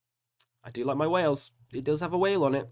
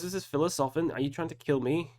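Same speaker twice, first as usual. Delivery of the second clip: usual speaking voice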